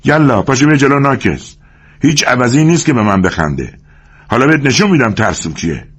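understated fury; losing his temper. Acted. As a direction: flare up and challenge him. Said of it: "از کوره در رفت".

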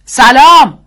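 loud, high call into the speaker; husband shouting hello into the radio speaker. A narrator's reading. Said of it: "بلند توی بلندگو گفت".

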